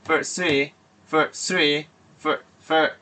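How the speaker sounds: For three with a long er vowel, not hesitation; long er in first. Shown as a demonstration. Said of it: "এখানে long কিন্তু hesitation না".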